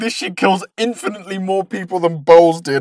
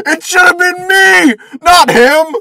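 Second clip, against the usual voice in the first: high, loud, drawn-out wail; mock anguished cry; 14 semitones higher, 6 dB louder, slower than usual. Fan laments not taking the character's place, mock anguished shout. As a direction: wail it with hammed-up jealousy.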